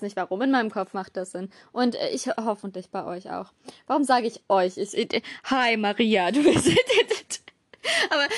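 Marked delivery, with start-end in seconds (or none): 5.4-8.3 bursting into giggles; breaking up laughing mid-sentence. Real, not acted. Laughs mid-greeting to Maria.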